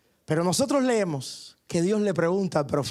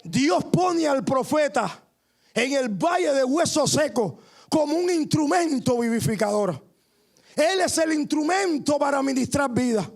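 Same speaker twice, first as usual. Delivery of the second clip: rising sermon fervor; 7 semitones higher than usual. Acted. Preacher urging the congregation to see through faith, fervently. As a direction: preach it out with growing conviction.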